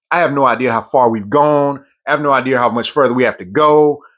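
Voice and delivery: normal talking voice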